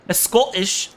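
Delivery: high mock Scottish echo; Scottish accent.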